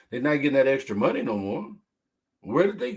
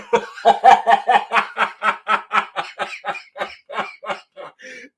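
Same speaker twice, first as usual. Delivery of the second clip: loud, high, rising fit of laughter; howling laugh; 8 semitones higher, 6 dB louder than usual. Real, not acted. After the loser joke, loud high howling laugh. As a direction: laugh helplessly.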